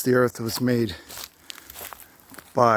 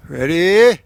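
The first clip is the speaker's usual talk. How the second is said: loud call; 4 semitones higher, 10 dB louder than usual. Real, not acted. Calls out before moving on.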